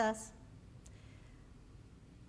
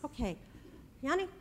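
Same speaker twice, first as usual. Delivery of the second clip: ordinary talk, nothing marked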